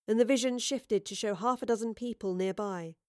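ordinary delivery

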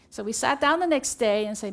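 usual speaking voice

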